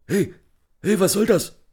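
high, loud panicked shout. A narrator's reading. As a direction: yell in sudden alarm. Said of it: "klang panisch". (panisch aufgeschrien)

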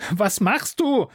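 frightened call upward. Acted. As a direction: cry out to the empty sky. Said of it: "rief ich in den blauen Himmel".

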